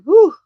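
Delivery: loud high whoosh of breath at the cold; sharp cold whew. Real, not acted. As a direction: blow it out sharply, feeling the chill. Reacting to cold.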